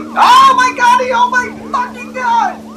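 panicked shout. Real, not acted. Sudden fright.

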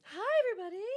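loud, high sing-out to chat; high-pitched greeting. Real, not acted. Streamer greets the returning chat.

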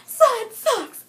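high, very loud heckle; comic retold yell; imitated shout. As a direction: shout it out like a cheeky pupil. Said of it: "he, he shouts".

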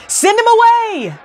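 high, sharp demand. A narrator's reading. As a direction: order him out. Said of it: "demanded a third mother".

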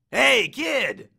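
loud, high hail; hearty shout. Acted. Ares greets Percy on the beach, shouting heartily. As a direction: call out boldly to the kid.

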